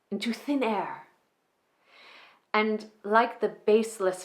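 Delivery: a magician gently consoling her new son-in-law as the revels end; Prospera's monologue. Acted. Performs Prospera's Tempest monologue.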